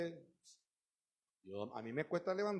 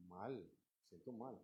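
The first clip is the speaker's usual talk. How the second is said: slow, much softer, deflated answer; gloomy reply; 5 semitones lower, 14 dB quieter, slower than usual. Acted. A downcast believer answering a greeting, gloomy. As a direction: let it sag out, low and tired.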